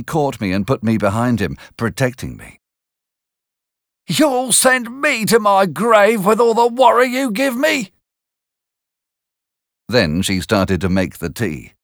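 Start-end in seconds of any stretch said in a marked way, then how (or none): 4.1-7.9 much higher scolding complaint; a hammed-up nagging woman; exasperated sister. A narrator's reading. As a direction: Mrs Joe nagging Pip. Mrs Joe complains to Pip.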